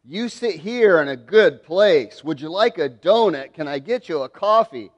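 loud, fawning host; mock eager welcome. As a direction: play up the hospitality for an important guest. Acts host fawning over rich man.

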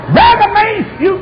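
high, loud holler after someone; high-pitched imitated holler; calling out. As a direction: shout the name urgently. Missionary calls out to fleeing preacher.

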